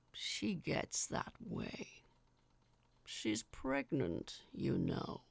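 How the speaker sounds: casual giant, smoothing things over; conversational giant. Acted. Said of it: "almost conversationally".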